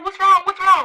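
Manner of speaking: high, loud urgent questioning; a panicked mom, acted out; imitated alarmed mother. Mom sensing trouble on the phone, alarmed.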